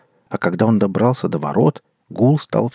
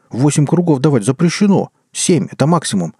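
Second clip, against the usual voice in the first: indignant protest, a bit louder; 6 semitones higher, 4 dB louder than usual. A narrator's reading. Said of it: "снова возмутился мужчина".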